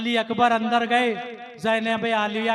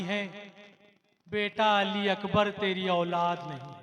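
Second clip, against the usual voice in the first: quiet weeping father; 4 semitones lower, 5 dB quieter than usual. Acted. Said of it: "रो के कहते हैं".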